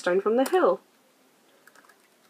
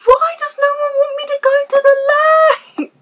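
much higher, louder mock whine; whiny put-on voice; 8 dB louder than usual. Frustrated player complaining about the lake, hammed-up whine. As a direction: whine it like a sulky cartoon child.

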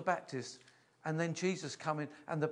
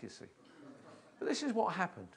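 ordinary delivery

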